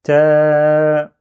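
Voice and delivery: "ta" held long and steady on one loud note; "taaa", a long sustained a; prolonged vowel. Shown as a demonstration. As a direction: stretch the vowel of "ta" right out. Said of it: "ça prolonge".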